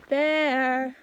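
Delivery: loud shout; scaring off a bear. Real, not acted. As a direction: yell it out to scare a bear. Calling out to scare a bear.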